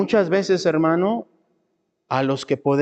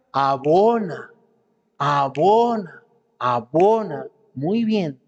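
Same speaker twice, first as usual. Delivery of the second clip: "abona" stretched long on the middle vowel; slow emphatic repetition; much slower than usual. Real, not acted. Preacher warning that the devil feeds bitterness, slow drawn-out repetition. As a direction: draw out each "abona", rising and falling.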